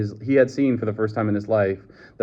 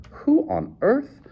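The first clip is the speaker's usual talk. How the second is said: high, slow incredulous question; astonished; slower than usual. A narrator's reading. Bernard faced with the strange young man, astonished. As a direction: draw it out high in amazement. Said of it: "began in astonishment".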